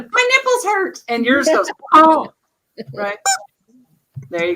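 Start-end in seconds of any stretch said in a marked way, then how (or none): 0.1-1.0 very high, squeaky toy-chicken voice. Pretend. 1.9-2.3 chicken voice. Pretend. Voicing the other toy chicken.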